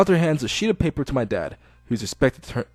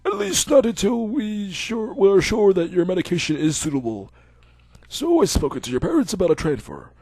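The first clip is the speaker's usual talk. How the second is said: put-on old doctor; 6 semitones higher than usual. Old doctor telling Hisao he can go home.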